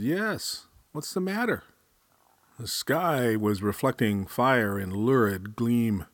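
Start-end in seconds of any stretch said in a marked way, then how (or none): 0.0-1.6 high, startled question. Acted. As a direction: ask it in alarm. Julia wakes to the fire.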